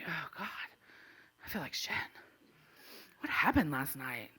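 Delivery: low, soft morning-after misery; hungover groaning. Retold or imitated. Woman waking up after last night, low hungover mumble. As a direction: drag it out quietly, barely awake.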